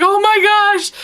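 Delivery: high, loud startled cry; imitating her own fright; startled gasp. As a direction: jump out of your skin. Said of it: "I was like".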